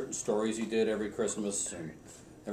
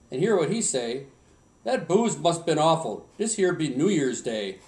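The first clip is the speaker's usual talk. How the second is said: light Swedish dialect, louder for the punchline; grandpa's Swedish poem, light accent; 4 semitones higher, 5 dB louder than usual. Retold or imitated. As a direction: land the boss's punchline in Swedish lilt. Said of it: "in my best Swedish accent".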